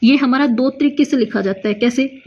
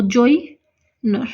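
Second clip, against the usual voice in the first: slow drawn-out word; much slower than usual. Shown as a demonstration. Teacher dictating while writing shorthand, slow and stretched. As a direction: stretch joiner out syllable by syllable, slowly. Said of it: "इस तरीके से".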